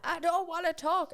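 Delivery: high, loud imitation of an upset child; upset little boy, pitched right up. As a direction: mimic an upset kid refusing. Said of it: "he was still really upset".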